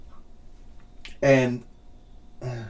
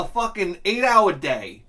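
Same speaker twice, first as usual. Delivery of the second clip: usual speaking voice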